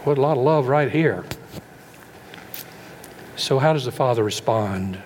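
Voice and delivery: ordinary talk, nothing marked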